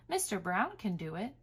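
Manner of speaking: ordinary delivery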